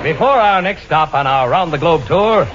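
ordinary talk

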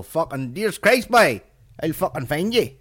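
loud, high, hammed-up Northern Irish threat; exaggerated Belfast outburst, pitch leaping up; Liam Neeson. Comedian parodying the actor's threat, loud hammed-up impression. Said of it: "He's all".

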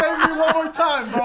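laughing. Real, not acted. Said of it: "Tell me".

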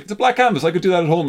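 high, loud scoffing skeptic; mocking skeptic. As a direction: scoff like an unimpressed visitor. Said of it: "Everyone's like".

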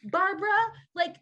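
high, slow mock exasperation; exasperated retort. Telling the clerk off at the airport, half-laughing exasperation. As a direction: replay her frustration with a laugh. Her angry reply retold.

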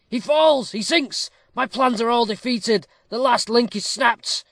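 loud, high-pitched frantic outcry; crying out. A narrator's reading. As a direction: cry out in despair as all is lost. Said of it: "cried Peter".

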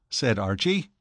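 usual speaking voice

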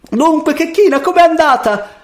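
very high, loud calling; shouted at full voice. A narrator's reading. Mother calling to her returning daughter, shouting. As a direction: holler it eagerly from afar. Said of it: "le gridò a piena gola".

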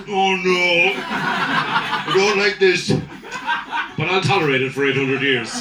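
slow, drawn-out mock dismay; long-suffering Irishman putting up with it; resigned dismay. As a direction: groan it out and give in. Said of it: "And you go".

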